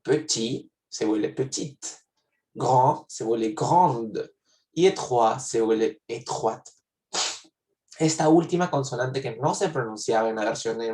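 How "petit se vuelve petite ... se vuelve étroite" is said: slow "petit" with silent T, then "petite" with the T sounded; masculine then feminine. Shown as a demonstration. Teacher to students, slowly contrasting silent and sounded endings. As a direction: contrast "grand" with silent D against "grande" with the D sounded. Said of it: "sí se pronuncia en la versión de femenino".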